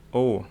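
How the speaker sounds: O with lips drawn slightly tight; close, rounded "O"; bassy rounded O. Shown as a demonstration. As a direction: say "O" narrow-mouthed and bassy. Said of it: "biar ngebas".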